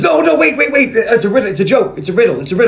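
high, loud, urgent "wait, wait"; mock frantic Zeus. Zeus stopping John from dialing, frantic high warning.

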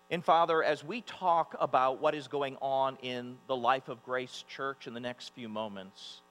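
normal talking voice

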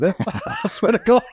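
laughing. Real, not acted. Laughing about wife's mint sauce.

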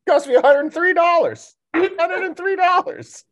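high-pitched comic complaint; mock outrage. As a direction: squeal about the cost in jest. Comic complaint about the bill.

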